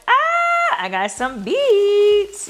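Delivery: high, slow excited reveal; light up on the beets. Real, not acted. Showing her haul to viewers, high excited.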